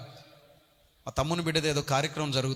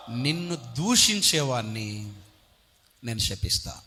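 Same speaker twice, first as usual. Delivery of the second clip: slow, low, ominous voice of God; God's warning; 4 semitones lower, slower than usual. Acted. God warning Abraham of the curse, slow and grave. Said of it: "అబ్రహాముకు దేవుడిచ్చిన వాగ్దానం".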